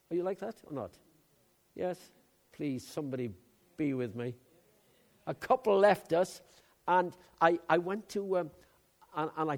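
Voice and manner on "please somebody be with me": usual speaking voice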